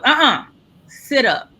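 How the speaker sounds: an auntie who won't take cool for an answer; firm auntie. Retold or imitated. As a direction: radiologist telling the patient to sit up, firm auntie. Radiologist gives an auntie moment.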